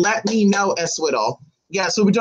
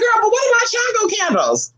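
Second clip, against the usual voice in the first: exasperated. Retold or imitated. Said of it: "And I'm just like".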